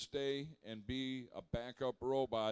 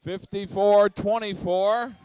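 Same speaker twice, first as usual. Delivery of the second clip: game announcer; 5 semitones higher, 17 dB louder, slower than usual. Real, not acted. Announcing picked team to crowd.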